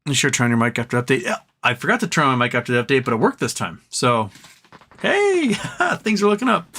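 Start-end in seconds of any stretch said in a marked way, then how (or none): 5.0-6.7 bright dog talk. Real, not acted. Cheering up the dog.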